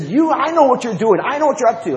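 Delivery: high, loud angry accusation; yelling. Retold or imitated. Retelling an angry preacher confronting him, yelling. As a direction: yell it as an irate preacher would. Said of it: "he was yelling".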